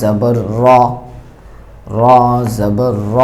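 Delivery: র said with a thick, rounded vowel; র voiced heavy, between a and o; heavy র demo. Shown as a demonstration. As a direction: recite জবর র with a full-mouthed dark a. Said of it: "আওয়াজটা একটু মোটা হবে".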